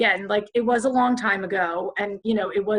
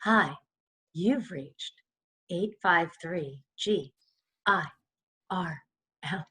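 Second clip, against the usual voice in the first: low hushed seductive answering machine message; sultry voicemail message; 4 semitones lower, 8 dB quieter than usual. Retold or imitated. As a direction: purr the old voicemail like a come-on. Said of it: "we did something like this".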